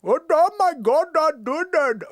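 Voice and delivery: loud, high, singsong mock Indian accent. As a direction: let the Indian accent swoop all over. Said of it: "You could go all over with that one".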